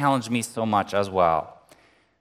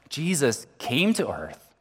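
normal talking voice

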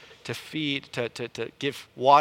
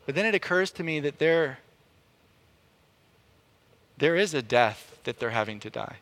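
normal talking voice

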